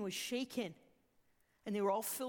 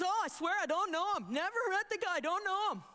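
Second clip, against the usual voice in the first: higher-pitched, acted-out protest; insistent denial; 7 semitones higher, 4 dB louder than usual. Peter denies Jesus to an accuser, insistent denial. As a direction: protest innocence, pitched up, as retold.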